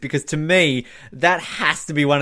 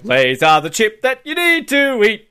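mock ad voice; 8 semitones higher, 4 dB louder than usual. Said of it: "just cut to an ad".

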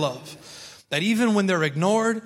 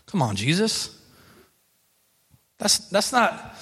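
ordinary talk

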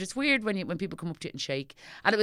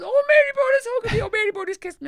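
much higher and louder squeal; hammed-up fan squeal; 11 dB louder than usual. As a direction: shriek it like a thrilled teenager. Fans squealing over hugs.

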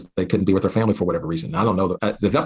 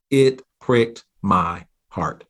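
usual speaking voice

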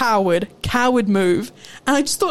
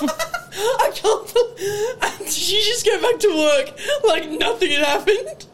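real high, wheezing laughter through words; helpless laughter; 8 semitones higher, slower than usual. Real, not acted. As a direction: barely get the question out for laughing. Helpless laughter at the pizza story.

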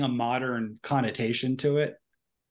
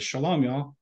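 nonchalant; 3 semitones higher than usual. Retold or imitated. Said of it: "he's all nonchalant about it".

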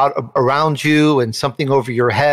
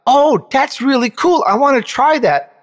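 eager excitement; 9 semitones higher than usual. Retold or imitated. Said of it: "I thought".

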